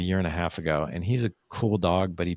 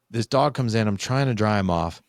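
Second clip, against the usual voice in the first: ordinary delivery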